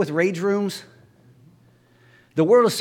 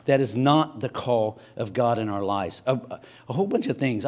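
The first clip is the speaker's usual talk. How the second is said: ordinary delivery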